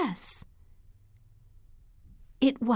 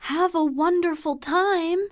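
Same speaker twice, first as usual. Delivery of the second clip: calling out; 7 semitones higher than usual. A narrator's reading. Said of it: "she called".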